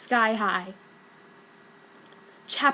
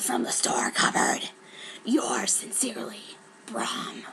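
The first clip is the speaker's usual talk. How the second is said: whispered creepy letter voice; villain's note; 2 semitones higher than usual. Acted. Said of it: "note signed by Brom".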